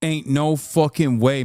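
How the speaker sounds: ordinary talk, nothing marked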